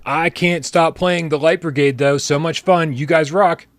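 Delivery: loud, high-pitched announcer-style read; hyped tip read. Real, not acted. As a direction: belt out the viewer message with gusto. Reads out a viewer's tip.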